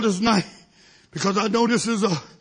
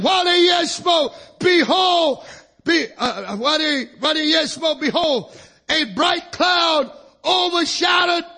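higher, louder, shouted text; preached scripture; 5 semitones higher, 4 dB louder, slower than usual. Acted. Preacher shouting the Bible verse to his church. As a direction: proclaim the verse like a revival preacher.

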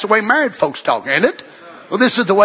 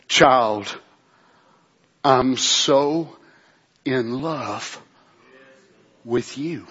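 much lower and softer, slow and hushed; kind tender voice; 9 semitones lower, 5 dB quieter, much slower than usual. Acted. God answering his child, hushed and tender. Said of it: "his kind, tender voice".

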